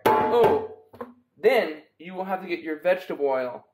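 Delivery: ordinary talk, nothing marked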